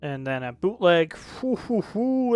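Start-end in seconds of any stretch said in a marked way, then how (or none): 1.4-2.3 high whooping. Real, not acted. Reviewer reacting to a skipped track, high whoops. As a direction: whoop at how bad it is.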